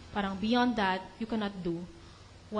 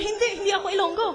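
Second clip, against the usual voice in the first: higher, fast, laughing protest; Peter's flustered denial; 9 semitones higher, 6 dB louder, faster than usual. Retold or imitated. Peter denying he is Ilonggo, hammed-up and laughing. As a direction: deny it in a comic panic. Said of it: "dinideny pa ni Peter".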